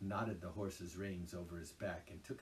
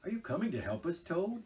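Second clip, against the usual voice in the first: stern, raised demanding voice; 10 semitones higher, 7 dB louder than usual. A narrator's reading. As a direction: demand it sternly, much higher and louder. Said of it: "he demanded sternly".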